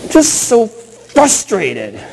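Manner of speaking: put-on slow, high, drawn-out child's voice; little girl's voice. Sulky daughter in the car seat, high and slow. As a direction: play the four-year-old, sulky and slow. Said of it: "She's like".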